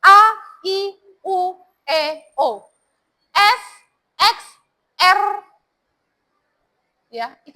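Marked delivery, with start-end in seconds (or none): 0.0-5.2 "A, I, U, E, O. S, X, R." each letter crisp, loud and set apart; clear letter articulation. Shown as a demonstration. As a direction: drill "A, I, U, E, O" then "S, X, R" like a diction exercise. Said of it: "harus jelas lafal kamu". (lafalkan "A, I, U, E, O. S, X, R." satu per satu dengan jelas)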